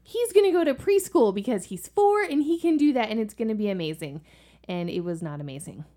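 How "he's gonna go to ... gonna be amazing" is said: mock hopeful parents. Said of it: "we were like".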